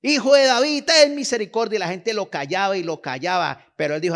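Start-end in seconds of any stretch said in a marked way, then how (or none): 0.0-1.8 loud, high desperate cry for mercy. Retold or imitated. Blind Bartimeo shouting to Jesus over the crowd, pleading loudly. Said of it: "ese comenzó a gritar".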